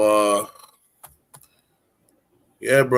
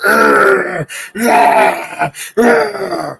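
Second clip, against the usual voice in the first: loud pained growl; pained groan; 2 semitones higher, 6 dB louder, much slower than usual. Retold or imitated. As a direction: groan through the pain. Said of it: "walk down that street going".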